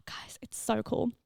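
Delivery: hushed, breathy whisper of delight; excited whisper. Real, not acted. To listeners about in-person clients, an excited whisper. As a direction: lean in and whisper the thrill.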